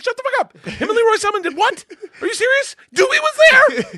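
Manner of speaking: very high, loud squealing shout; shrieking disbelief. Retold or imitated. Told his old teammates came to church, shrieking in disbelief. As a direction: squeal it like a starstruck fan.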